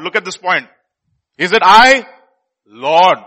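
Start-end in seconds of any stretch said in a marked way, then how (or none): 1.4-3.2 loud questioning plea, LORD set apart after a pause; punchy, emphatic plea to the master; disciples' earnest asking. Acted. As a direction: ask it loudly and urgently as a worried disciple. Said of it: "they were very sorrowful".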